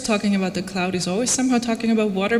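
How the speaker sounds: normal talking voice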